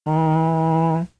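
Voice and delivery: one long open vowel; held vowel. Shown as a demonstration. Teacher demonstrating a single vowel. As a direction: sustain an open ah. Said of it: "How many do you hear".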